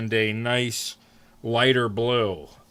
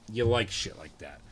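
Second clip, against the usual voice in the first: ordinary talk, nothing marked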